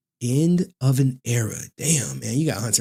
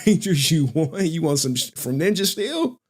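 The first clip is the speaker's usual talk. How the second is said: normal talking voice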